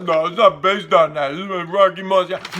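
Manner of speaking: Stallone impression. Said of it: "And Stallone's like".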